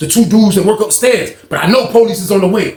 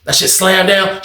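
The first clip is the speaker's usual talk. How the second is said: ordinary talk, nothing marked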